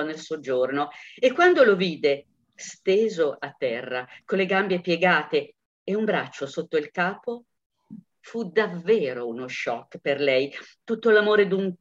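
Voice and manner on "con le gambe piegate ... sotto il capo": ordinary delivery, nothing marked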